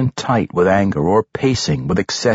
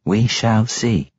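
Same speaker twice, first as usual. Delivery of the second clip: slow, drawn-out matron echo; matronly voice; 3 semitones higher, slower than usual. Acted. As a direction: let the words hang slowly. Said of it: "said the matron mother".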